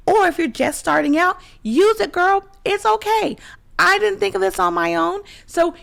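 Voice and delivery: high, loud, cheerful encouragement; excited. Real, not acted. Cheering the viewer on.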